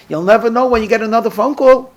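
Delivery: joking. Retold or imitated. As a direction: toss it off as a light joke. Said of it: "And then jokingly he says".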